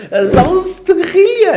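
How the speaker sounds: high, slow laugh through the words; giggling it out. Real, not acted. Laughing at the fake accent.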